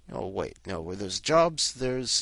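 ordinary talk, nothing marked

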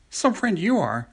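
high, slow squeak; squeaky rabbit voice. A narrator's reading. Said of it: "squeaked the rabbit".

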